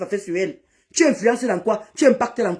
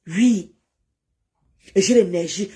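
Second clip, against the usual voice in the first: normal talking voice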